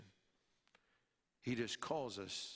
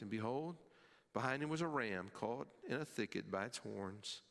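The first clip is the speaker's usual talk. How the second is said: ordinary delivery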